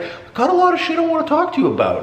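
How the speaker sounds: high, fast squeaky voice; mock-excited falsetto rush; excited self-quote. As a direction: blurt it out in a very high, rapid voice. Acting out his excited opening.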